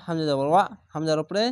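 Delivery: ordinary talk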